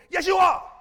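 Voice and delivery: loud, insisting reply; emphatic insistence. Acted. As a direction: overrule the doubter with a shout. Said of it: "insisting back".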